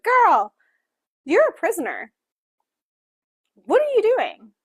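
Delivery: high, loud mock telling-off; exasperated scolding. Reader calls out the captive heroine, exasperated scolding. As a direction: scold her in disbelief, pitch leaping up.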